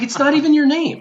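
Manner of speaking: high-pitched imitation of mom's correction; matter-of-fact mom. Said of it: "And she's like".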